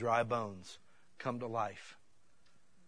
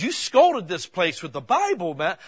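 encouraging praise; 4 semitones higher, 9 dB louder than usual. Retold or imitated. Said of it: "like he was building me back up".

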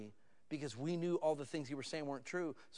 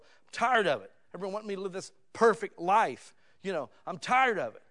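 fed up; 6 semitones higher, 10 dB louder than usual. Retold or imitated. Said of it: "people say that about God's world".

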